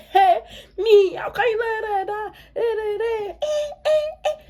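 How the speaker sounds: high-pitched, mock-furious outburst; going off. Hot-tempered G T kicks off at an online troll, mock outrage. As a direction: imitate someone flying off the handle, voice shooting up. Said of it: "she was already going off".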